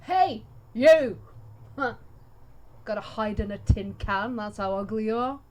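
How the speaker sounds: loud, in-character shouted insult; shouted taunt. Acted. Player's character hurls mockery at an enemy, shouting. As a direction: call them out at full volume, jeering. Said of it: "he shouts at him".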